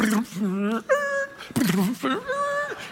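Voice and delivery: mouth-made rumble rising into a squeal; comic vehicle sound effect; chariot noise imitation. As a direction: imitate wheels running over something. Imitates chariot running her over.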